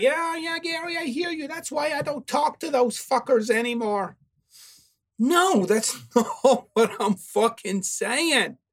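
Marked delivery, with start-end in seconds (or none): 0.0-4.2 higher, lightly mocking brush-off; mock dismissive listener. Imitating listeners who cut off family, mildly mocking. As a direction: parody a smug listener waving you off. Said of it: "some of you are like".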